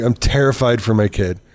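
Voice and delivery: ordinary talk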